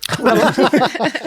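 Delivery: sudden high burst of laughter at the joke; real amused laugh. Real, not acted. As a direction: laugh out loud at the pun. Joke about the lawyer.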